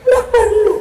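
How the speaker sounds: high, loud "done, no need!"; a cheeky, high-pitched victory cry; triumphant exclaim. Acted. Punchline, app solves everything.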